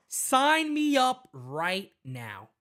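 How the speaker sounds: "Sign me up right now" slow and deliberate; emphatic. Real, not acted. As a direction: spell out "Sign me up right now" slowly, with weight on SIGN. Repeats the demand for emphasis.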